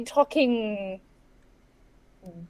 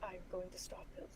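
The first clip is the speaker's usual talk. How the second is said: whispering; 5 semitones lower, 25 dB quieter than usual. Real, not acted. Whispered joke.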